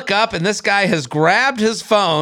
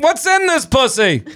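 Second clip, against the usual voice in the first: put-on old prospector; 7 semitones higher than usual. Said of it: "he's like an old prospector".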